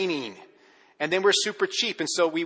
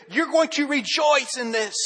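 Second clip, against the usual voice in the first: slow, higher, louder demand; arm-twisting command; 10 semitones higher, 5 dB louder than usual. Retold or imitated. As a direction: force the joy on them. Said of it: "twist your arm saying".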